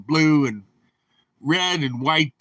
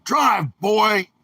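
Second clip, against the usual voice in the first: sharp, raised shout, a bit higher; barked command; 5 semitones higher than usual. Retold or imitated. Officer in the cab shouting at the trucker to drive, barking. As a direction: snap the order out like a cop.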